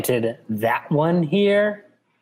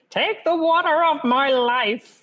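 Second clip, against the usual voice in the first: put-on high, slow wailing old servant; wailing Mapes; 14 semitones higher, slower than usual. A narrator's reading. Mapes to Jessica, high slow wail. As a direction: wail it out as the housekeeper.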